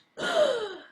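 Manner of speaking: sharp, high mock gasp; loud imitation of a collective gasp; shocked gasp. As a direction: gasp loudly like a scandalized crowd. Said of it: "everybody gasped".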